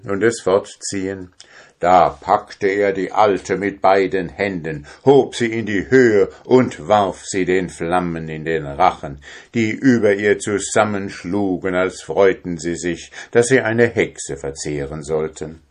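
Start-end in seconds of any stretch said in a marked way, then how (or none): none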